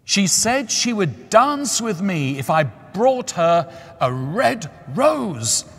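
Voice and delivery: put-on young student crying out, high and loud; a lovesick youth crying out, slow. A narrator's reading. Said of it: "cried the young student".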